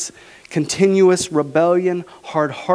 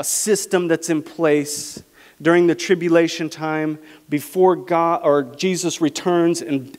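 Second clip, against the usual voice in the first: usual speaking voice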